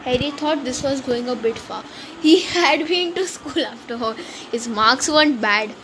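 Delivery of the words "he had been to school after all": plain reading voice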